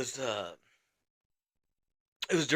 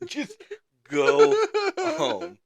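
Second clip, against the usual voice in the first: "Just", "go", "home" split by pauses; exasperated plea; 6 dB louder, much slower than usual. Retold or imitated. Corporal begging drunk sergeant to leave, each word of "Just go home" set apart. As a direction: break "Just go home" into separate, higher-pitched words.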